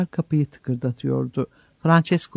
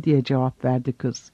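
plain reading voice

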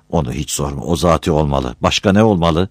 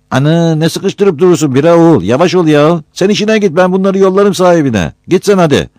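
put-on high, loud cracked old man scolding; old hoca voice; 13 semitones higher, 6 dB louder than usual. Old imam shoos Battal away from the dishes, high and loud. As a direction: play a fussy old village imam shooing him away.

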